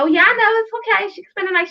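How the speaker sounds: lightly mock the other mom's easygoing yes; other mom on phone. Mother retelling the phone call, mimicking the other mom's breezy voice. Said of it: "and the mom was like".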